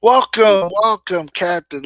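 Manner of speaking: loud welcome. Real, not acted. Host greeting the guest onto the stream, loud and excited. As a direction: call out the welcome with gusto.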